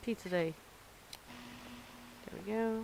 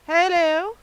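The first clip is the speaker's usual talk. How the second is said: very loud, higher cheery hello; loud greeting; 7 semitones higher, 12 dB louder than usual. Real, not acted. Cook greeting an arriving customer, loud hello. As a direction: call out a bright welcome.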